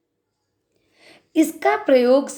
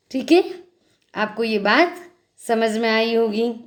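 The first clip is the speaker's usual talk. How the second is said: usual speaking voice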